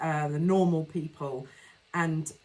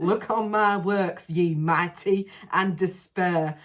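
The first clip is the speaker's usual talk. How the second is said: slow, hammed-up Yorkshire accent; exaggerated northern accent; 4 dB louder, slower than usual. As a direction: overdo the northern English accent, drawn out. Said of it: "I am obviously exaggerating that".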